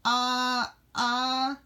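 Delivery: high, halting filler; hesitant stalling. Acted. Lassie must answer her mother, high hesitant stalling.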